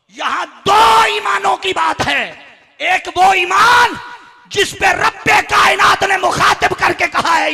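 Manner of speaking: high, loud sermon shout; full-force preaching. Acted. Preacher telling the congregation of two faiths, thundering. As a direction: drive it out to the whole hall, high and drawn-out.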